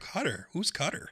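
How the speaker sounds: quiet, baffled mock reaction; puzzled aside. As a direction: drop the voice as if privately confused. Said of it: "I was looking like".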